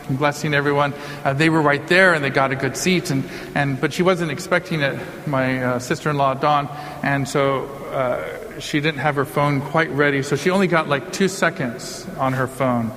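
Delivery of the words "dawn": normal talking voice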